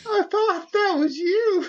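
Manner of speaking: high, slow, crying character voice; slow falsetto whimper. Acted. Susie Soccer whimpering to Tony, high and slow. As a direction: whimper it in a squeaky voice.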